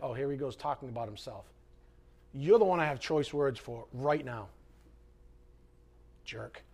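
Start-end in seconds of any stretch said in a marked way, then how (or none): 0.0-1.4 low, soft, quick mock of a scoffer; mocking whiny listener. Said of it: "you're like". 6.2-6.6 low, soft muttered insult; muttered aside. Acted. Aside insult to arrogant listener.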